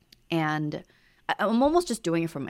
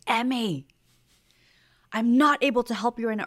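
higher, louder retold snap at a child; raised voice; 6 semitones higher, 5 dB louder than usual. Parent calling to child at the pool, retold raised voice. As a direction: imitate losing patience, voice raised. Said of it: "I raised my voice".

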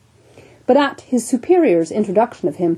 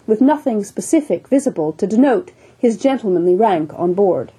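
ordinary delivery, nothing marked

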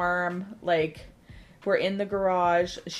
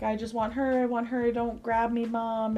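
higher-pitched imitation of a whining child; Addie throwing a fit; 4 semitones higher than usual. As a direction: plead like a kid in a tantrum. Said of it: "Addie's throwing this fit".

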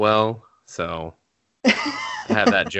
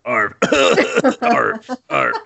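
high, loud yappy arf, arf; yappy little dog; 4 dB louder, much slower than usual. Shown as a demonstration. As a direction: yap like a tiny dog. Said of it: "they're not even trying to sound like a dog".

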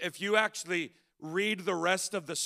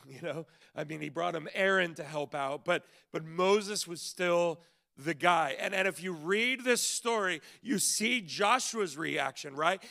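ordinary delivery

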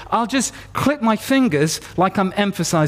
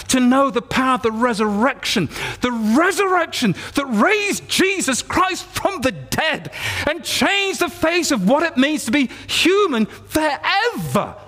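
impassioned preaching climax; 6 semitones higher than usual. Acted. Preacher exalting the power of the resurrection, fervent climax. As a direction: proclaim it with soaring intensity.

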